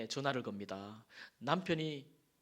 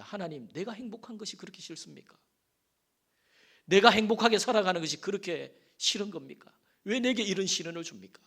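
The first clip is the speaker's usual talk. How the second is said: a bitter plea that rises higher and turns loud; resentful plea to God; 5 semitones higher, 5 dB louder than usual. Retold or imitated. Grieving widow reproaching God, building to a loud cry. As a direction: cry out your grievance, building from hurt to protest. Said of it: "하나님을 향해서 원망이 시작합니다".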